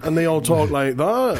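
imitated Scottish speech; Scottish accent. Shown as a demonstration. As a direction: switch into a Scottish lilt. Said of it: "you're in Scotland".

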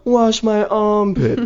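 slow, drawn-out high singsong; mock the server's put-on hospitality voice; exaggerated cheery croon; over-friendly server. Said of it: "is this really who you".

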